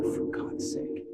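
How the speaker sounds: under-the-breath exasperation, barely voiced; low-volume, drawn-out exasperated aside; exasperated mutter. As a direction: mutter it wearily to yourself, almost a whisper. Exasperated aside at bad news.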